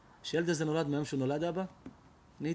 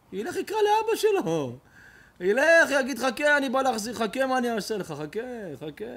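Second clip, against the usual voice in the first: mock threatening child; 8 semitones higher, 7 dB louder than usual. Stranger's kid threatens revenge.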